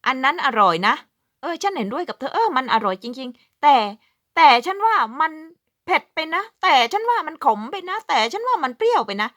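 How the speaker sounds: high, loud playful complaining voice; mock picky agreement. Joking example of a Finn agreeing then finding fault, high and loud. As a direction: act out agreeing then nitpicking. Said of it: "พูดง่ายๆว่า".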